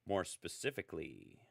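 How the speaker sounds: ordinary delivery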